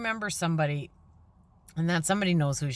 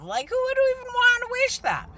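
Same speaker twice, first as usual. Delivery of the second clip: high, loud incredulous squeal; who on earth would want that, pitched way up; squeaky disbelief; 4 dB louder than usual. Real, not acted. Exasperated rhetorical question.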